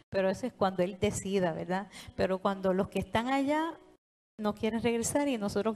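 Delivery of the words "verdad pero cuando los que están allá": usual speaking voice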